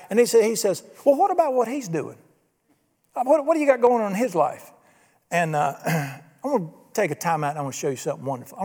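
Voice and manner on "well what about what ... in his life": higher-pitched mock challenge; nosy questioning. Simon pestering Jesus about the other disciple, nosy questioning. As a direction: demand to know about the other man, pitched up.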